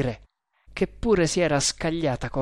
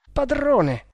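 high, loud frightened shout; terrified cry; 11 semitones higher, 3 dB louder than usual. A narrator's reading. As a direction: cry out in terror. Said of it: "esclamò il Maharatto atterrito".

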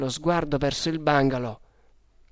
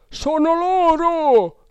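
high cry with drawn-out vowels; shouted alarm; 6 dB louder, much slower than usual. A narrator's reading. Second man on the terrace spotting the fugitives, high drawn-out shout. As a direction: raise the alarm at full voice. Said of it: "gridò un'altra voce".

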